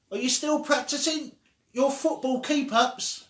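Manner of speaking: high, loud call across the street; child calling to her friend across the street. Acted. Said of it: "They had to use loud voices".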